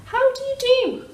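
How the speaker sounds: high, loud chirpy duck greeting; duck's high cheery hello; polite duck. Acted. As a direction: greet him brightly like a duck.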